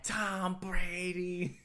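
loud, high, stretched Boston caricature; Boston accent. Acted. Mocking a Boston guy, loud Boston accent. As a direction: bellow it slowly in a Boston accent. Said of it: "Fucking Boston".

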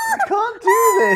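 high squeal through laughter; laughing shriek. Pretend. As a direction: shriek while cracking up. Mock outrage while laughing.

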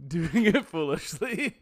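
slow, laughing repeat; laughing the line out. Real, not acted.

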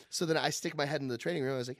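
ordinary talk

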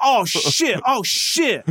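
high, loud panicked yelps; panicked dentist. Retold or imitated. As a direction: yelp it out in mock alarm. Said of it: "he goes".

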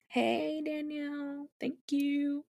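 high, soft singsong greeting; cutesy shout-out. Real, not acted. Co-host thanking a listener, in a cutesy singsong. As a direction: lilt it sweetly, high and light.

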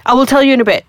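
ordinary talk

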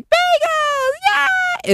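put-on very high squealing child voice; imitating a kid's shrill cheer; excited little kid.

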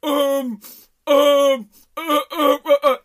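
exaggerated strained uhs and ums; flustered stuttering. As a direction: imitate a cornered kid lost for words. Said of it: "he started stuttering. He didn't know what to do".

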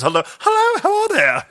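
very high, silly British greeting; mock British accent. Comic voices a body part greeting co-host, high mock British. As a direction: pipe up in a cartoonish British falsetto. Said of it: "is fucking British now".

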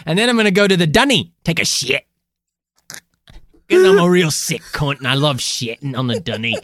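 fast hammed-up Aussie rant; mock Australian accent. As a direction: rattle it off like a rowdy Aussie. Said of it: "this authentic Australian accent".

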